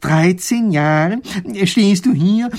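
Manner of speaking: slow start rising high and loud; incredulous professor. A narrator's reading. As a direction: spell out the absurdity in disbelief. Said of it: "will es immer noch nicht glauben".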